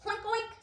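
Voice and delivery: high oinking; pig oink. Acted. Reading Pig's line to kids, high oinks. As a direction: oink like a piggy. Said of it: "said pig".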